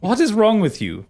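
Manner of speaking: incredulous scolding. Acted. Minerva mocking the dragon's one-liner, incredulous. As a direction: scoff at how lame the line was. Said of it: "she looks at you and goes".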